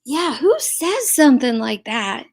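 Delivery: loud, indignant, jumping high on the last word; real outraged disbelief. Real, not acted. Host reacting to a cruel replayed remark, loud outrage. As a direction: demand an answer, loud and incredulous.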